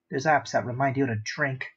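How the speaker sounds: ordinary delivery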